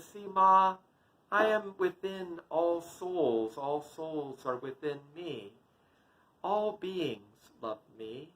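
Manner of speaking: put-on slow, soft, serene child-god; sweet smile. Smiling toddler god reassures his mother, slow sweet calm. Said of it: "Krishna just sweetly smiles".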